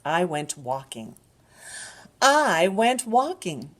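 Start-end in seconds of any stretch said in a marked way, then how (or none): 2.2-3.7 higher, brighter storybook voice; lively read-aloud for little ones; reading to a toddler. Shown as a demonstration. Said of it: "repeated line, livelier take".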